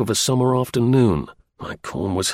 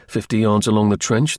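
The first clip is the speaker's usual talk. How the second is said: plain reading voice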